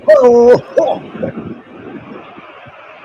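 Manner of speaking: booming, high sidekick belly laugh; loud imitation of a TV sidekick's hearty guffaw; McMahon's laugh. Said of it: "old McMahon and McMahon laughing".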